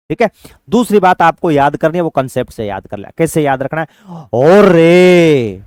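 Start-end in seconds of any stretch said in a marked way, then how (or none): 4.3-5.6 loud, very slow wailing "ओ रे"; exaggerated lament; drawn-out cry of dismay. Shown as a demonstration. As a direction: stretch "ओ रे" out like a pained village cry. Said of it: "ऐसे बोलते हैं ना कई बार".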